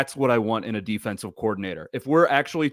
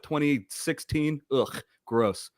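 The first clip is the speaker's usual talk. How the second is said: normal talking voice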